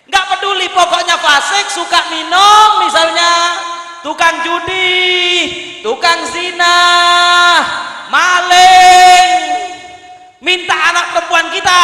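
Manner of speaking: loud, high sermon delivery; full-force preaching. Real, not acted. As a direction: preach it hard to a crowd.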